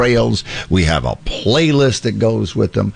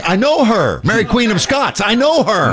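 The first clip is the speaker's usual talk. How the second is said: higher imitation of an excited Scottish lady; mock Scottish woman; 13 semitones higher than usual. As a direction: do the Scottish lady who claims to know the queen. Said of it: "I thought she was saying".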